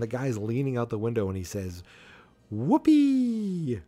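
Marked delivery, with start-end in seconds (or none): 2.5-3.8 loud, high, held-out whoop; hammed-up holler of joy, pitched way up; gleeful whoop. Reckless driver hollering out the window, gleeful whoop.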